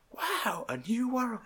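hushed, high-pitched mock awe; gobsmacked. Film dad marvelling at his son's news, gobsmacked. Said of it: "He was literally gobsmacked".

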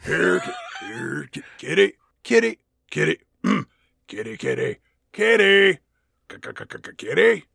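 choppy, repeated trial takes; stop-start kitty calling; stuttering cat call. Acted. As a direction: break the call into stuttered bits. Rehearsing the line, stuttering repeats.

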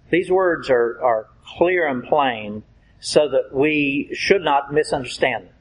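ordinary talk

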